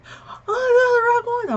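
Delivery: very high, loud fangirl squeal; retold excited squeal. Teenage fan celebrating The Rock's win, excited squeal. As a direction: squeal it out, imitating overjoyed fan. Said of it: "I was too excited".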